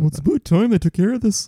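high-pitched mock Canadian voice; Canadian accent. Canadian chiming in on American affairs, mock Canadian accent. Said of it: "a Canadian jumped in there".

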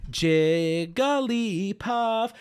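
Jigglypuff sung out very slowly as Jig-guh-lee-puff; imitating Jigglypuff's long, slow extended name; drawn-out name. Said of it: "Jigglypuff extends her name".

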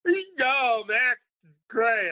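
put-on high, slurred, drunk on-air gushing; drunk self on air. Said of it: "By the time we do the interview, I'm smashed".

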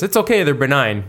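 playful doctor in a joke. Acted.

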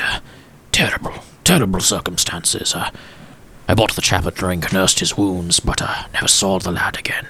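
lightly voiced old gent, hushed in places; a hint of a sorrowful old gentleman remembering; wistful old gentleman. [name] recalls a tragic friend.

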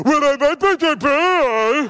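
high, slow, dopey mock husband voice; guy voice. Wife mocks her husband with the guy voice, high and slow. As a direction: drawl it like a clueless husband. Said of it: "You know the voice".

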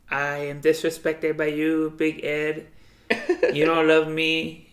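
slow, deliberate mock Filipino accent; imitated Filipino accent. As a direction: imitate the Filipino girlfriend's accented complaint, drawn out. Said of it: "That's what she talks to".

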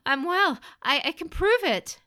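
high, loud, panicked pleading; desperate protest. Acted. Son begging mother to believe him, desperate. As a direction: stumble and cry out in desperation.

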